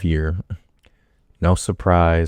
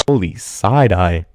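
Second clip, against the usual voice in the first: ordinary talk, nothing marked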